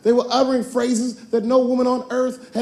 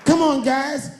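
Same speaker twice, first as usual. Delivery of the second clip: heroic leader voice; 3 semitones higher, much slower than usual. Acted. Leader rallies the Care Bears.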